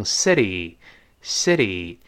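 "city" as "siddy", the t softened to a flap; voiced flap t. Shown as a demonstration. Teacher showing fast-speech city, t voiced to a flap. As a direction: say "city" American style, with a quick d-like t. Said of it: "这个t会浊化".

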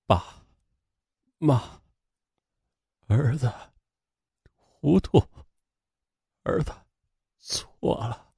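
very soft, halting words of repentance; remorseful confession. A narrator's reading. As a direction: confess brokenly, a word at a time. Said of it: "李东明向父亲忏悔道". (哽咽忏悔，断断续续)

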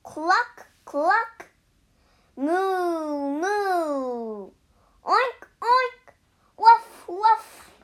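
high animal calls, building in volume; animals yelling. A narrator's reading. Farm animals try to wake the sun, yelling their calls. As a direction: go from clucks and long moos to loud oinks and barks. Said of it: "All the animals began to yell".

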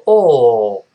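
drawn-out, rounded "哦" for British "all"; loud long "哦" with rounded lips; British rounded vowel. Shown as a demonstration. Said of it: "英国人都说哦".

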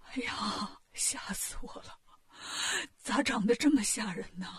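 soft, whispery gasps of shock; faint, frightened king. A narrator's reading. King revives after fainting at Wukong's face, feebly frightened. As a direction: come round weakly, still scared. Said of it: "吓晕过去了".

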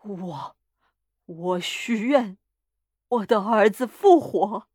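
hesitate, then force the wish out slowly; halting, dread-laden utterance; reluctant wish. A narrator's reading. Reluctant frightened wish in the story.

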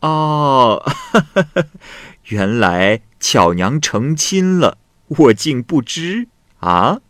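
slow amused words with a laugh; a touch of teasing laughter; laughing as she speaks. A narrator's reading. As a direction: chuckle through it, pleased at the news. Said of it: "华姑笑着说".

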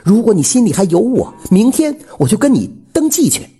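high-pitched, earnest woman's appeal; pleading widow. A narrator's reading. Widow proposing before he leaves, pleading.

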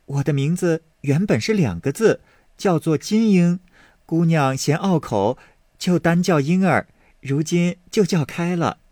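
a hint of a sweet young maid. Maid Yinger listing knot patterns to Baoyu, lightly girlish. Maid explains her name.

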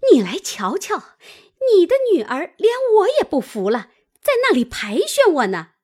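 high, fast, indignant tattling; complaining old woman. A narrator's reading. Aunt complains to the girl's mother, indignant. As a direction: call her over with a loud grievance.

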